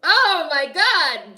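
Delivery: loud, slow "oh my God" in disbelief; shocked exclamation. Real, not acted. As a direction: drag it out, stunned. Shocked by the scene on screen.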